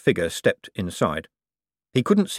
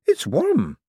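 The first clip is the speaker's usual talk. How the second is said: high, shocked gasp of a line; startled young man. Acted. Young man shocked at the warm ring. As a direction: jump at the touch of it.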